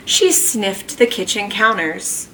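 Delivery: ordinary delivery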